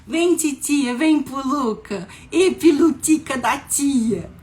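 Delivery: put-on loud, high cooing to a baby niece; cutesy baby talk. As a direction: gush in drawn-out auntie baby talk. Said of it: "eu vejo a minha sobrinha e falo".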